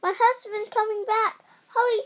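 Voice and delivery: high, loud, urgent warning; urgent wife. Acted. Giant's wife hurrying Jack into hiding. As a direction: rush Jack to hide, voice up high. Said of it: "she said".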